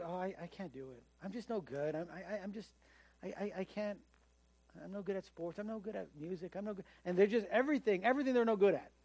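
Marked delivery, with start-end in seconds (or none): 0.0-6.8 mopey, much softer mumble of self-doubt; imitating self-pity. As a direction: mimic a mild, deflated self-pity. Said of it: "their attitude is always, "I can't".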